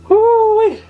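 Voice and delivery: very high, loud "woo" from the burn; spice whoop. Real, not acted. Guest reeling from hot sauce, spice whoop. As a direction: whoop out the heat.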